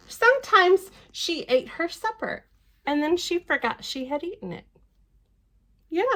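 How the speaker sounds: lively storybook reading voice; picture-book narration; reading to children. Acted. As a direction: read it out brightly to little ones. Reads the book aloud.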